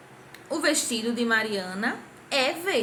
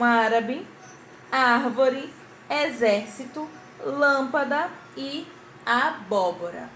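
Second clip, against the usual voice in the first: word list with "árabe, árvore, exército, lâmpada, abóbora" each hit on the third-last syllable; stressed antepenultimate syllables; slower than usual. Shown as a demonstration. Teacher showing pupils proparoxytones, third-last syllable of "árabe, árvore, exército, lâmpada, abóbora" stressed. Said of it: "que possuem a sílaba tônica".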